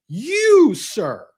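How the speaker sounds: sudden high outraged "You,"; a sharp, high, indignant jab of mock outrage.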